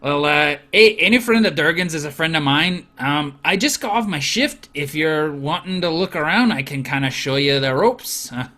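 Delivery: lightly voiced jovial dwarf; old friend introducing himself to the party; hearty old friend. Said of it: "GM continuing as Andrel".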